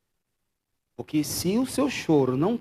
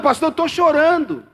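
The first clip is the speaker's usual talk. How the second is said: imitação chorosa; 13 semitones higher, 11 dB louder than usual. Retold or imitated. Pregador imita fiel chorando.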